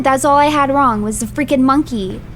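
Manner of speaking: ordinary talk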